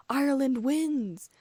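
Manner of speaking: high, loud, drawn-out commentator's cry; cried announcement. A narrator's reading. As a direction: proclaim the winner, stretching the words. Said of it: "Ludo Bagman cried".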